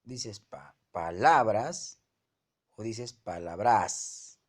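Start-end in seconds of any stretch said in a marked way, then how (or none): 0.9-2.0 "palabras" drawn out with loud stress on LA; teacher's demo of "palabras" stressed pa-LA-bras; stress on the middle syllable. Shown as a demonstration. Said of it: "La segunda que dije". 3.1-4.4 "palabras" slowed, stressed on final BRAS; stress on the last syllable. Shown as a demonstration. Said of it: "La mayor fuerza está en bras".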